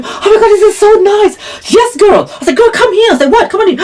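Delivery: high, squealing gush; gushing delight. Retold or imitated. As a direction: swoon over the fancy bathroom. Gushing over ladies' bathroom.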